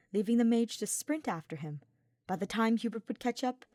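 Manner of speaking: ordinary delivery, nothing marked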